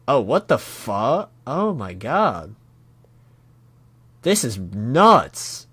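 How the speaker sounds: slow, drawn-out astonishment; aghast, dragging the words out; real shock. Real, not acted. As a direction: stretch each word out, stunned. Reader stunned by gruesome panel.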